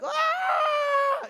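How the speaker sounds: put-on a sudden high, loud child's wail; imitated wailing cry. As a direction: burst out wailing like a little girl. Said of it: "doesn't start with just, like, crying".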